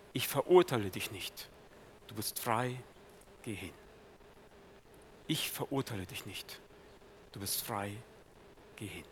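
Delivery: Jesus gently pardons the sinner, hushed and tender; tender forgiveness. Acted.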